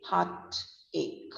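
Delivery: "heartache" in two separated parts, heart, ake. Shown as a demonstration. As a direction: say "heartache" softly, ending on a hard K. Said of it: "E R K".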